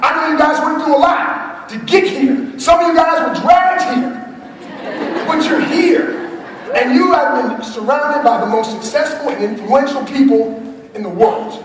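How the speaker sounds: rally the room like a revival preacher; preaching to the crowd. Acted. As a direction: speaker firing up the audience, in full preaching voice. Motivational preaching.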